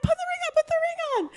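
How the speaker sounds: high squeal, softly held; mock-squeal of eagerness; excited demand. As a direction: imitate giddy, repeated pleading. Retelling her excited demand.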